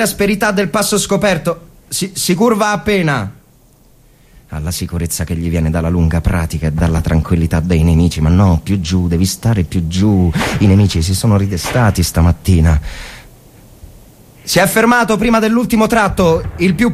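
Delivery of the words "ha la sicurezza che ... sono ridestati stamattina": inner monologue. Acted. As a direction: think aloud in the dark trench.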